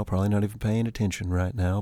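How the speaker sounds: ordinary delivery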